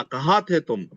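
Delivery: normal talking voice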